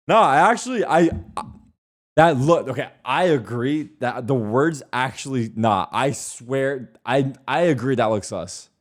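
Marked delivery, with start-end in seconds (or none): none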